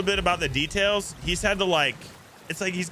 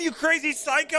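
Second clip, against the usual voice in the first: playful insult; 8 semitones higher than usual. Pretend. Jokey thanks to a subscriber.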